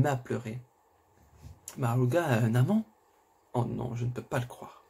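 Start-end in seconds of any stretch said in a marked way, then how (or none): none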